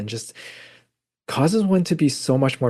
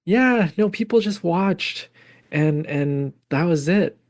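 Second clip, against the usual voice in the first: put-on higher, casual kid voice, easing down at the end; imitating her son; 7 semitones higher than usual. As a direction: play the son shrugging it off. Mother retells son's answer.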